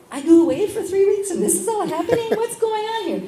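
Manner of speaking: high, incredulous and laughing; shocked disbelief. Retold or imitated. Tells the priest's friends how shocked she was, high and laughing. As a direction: lets the outrage ring out as a half-laugh. Retelling her shocked reaction.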